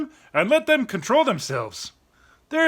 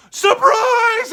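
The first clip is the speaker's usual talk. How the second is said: laughing surprise shout, much higher and louder; 12 semitones higher, 7 dB louder than usual. Retold or imitated. Friends yelling at someone walking into a dark house. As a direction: jump out and yell it with a laugh. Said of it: "everybody's like".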